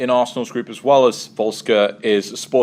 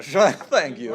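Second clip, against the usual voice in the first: mock Borat accent; 3 semitones higher than usual. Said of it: "That's my Borat accent as well".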